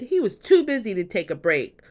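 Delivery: ordinary delivery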